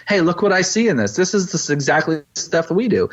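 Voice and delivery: put-on eager kid discovering something; excited child voice. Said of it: "he's just like".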